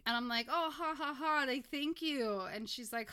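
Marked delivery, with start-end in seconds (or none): 0.5-2.4 loud, high-pitched mock laughter; polite fake laugh. Host laughs off the stranger's remark, awkwardly polite. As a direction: laugh it off politely, too loudly.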